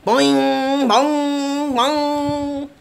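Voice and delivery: high, loud sung boing of a bell; playful vocal bell chime; bell ringing. Acted. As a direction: ring out like a church bell. Bell rings under nest.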